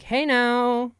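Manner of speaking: high, drawn-out sassy call; campy musical-theater sing-out; camp theater-kid greeting. Retold or imitated. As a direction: put on a flamboyant "hey now".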